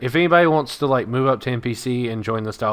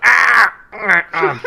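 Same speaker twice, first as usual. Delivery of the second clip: pained cries; 10 semitones higher, 10 dB louder, much slower than usual. Acted. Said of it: "He's like".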